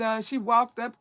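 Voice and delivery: ordinary talk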